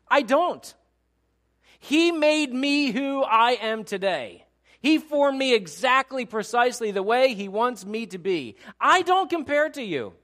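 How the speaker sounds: high, full-voiced shout of conviction; loud, emphatic declaration of self-acceptance; total change of tone. Shown as a demonstration. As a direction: proclaim it at the top of your voice. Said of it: "It's a total change of tone".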